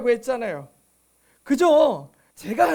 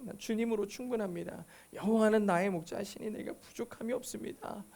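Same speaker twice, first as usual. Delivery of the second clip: much softer, prayerful recital; quiet confession; 3 semitones lower, 10 dB quieter than usual. Shown as a demonstration. Preacher modelling a believer's confession to God, hushed and simple. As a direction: say it low, like a private confession to God. 고백 기도를 시범으로 읊조림.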